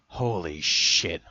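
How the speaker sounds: slow, stunned horror; horrified drawn-out oath. A narrator's reading. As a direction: draw it out, aghast.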